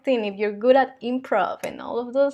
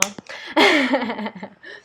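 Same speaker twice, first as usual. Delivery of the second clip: short shy laugh. Real, not acted. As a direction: laugh it off lightly. Laughs at her own English.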